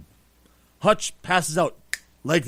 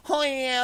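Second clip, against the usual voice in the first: higher, put-on moan; mock fake moan; 6 semitones higher than usual. Demonstrating the sound from the hotel neighbors, fake moaning. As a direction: imitate an exaggerated bedroom moan. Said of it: "that sound ... is what she sounded like".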